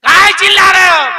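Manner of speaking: काहे चिल्ला रहे हो shouted high and loud; old woman shouts काहे चिल्ला रहे हो at full force; mock angry shout. Shown as a demonstration. Mother-in-law shouting काहे चिल्ला रहे हो at her son. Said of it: "setting देखिए".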